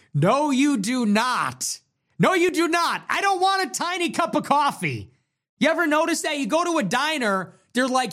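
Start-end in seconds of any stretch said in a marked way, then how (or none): 0.1-6.8 high, emphatic comic outrage; heated rebuttal. Acted. Arguing back at an imagined diner fan, heated. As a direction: pitch it up and refuse indignantly.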